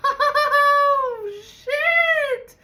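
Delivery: very high squealing laugh into a curse; laughing shock. Real, not acted. After the flame flare, squealing laughing oh shit.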